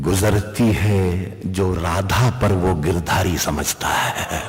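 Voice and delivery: low, hushed recital. Acted. Low soft recital of couplet.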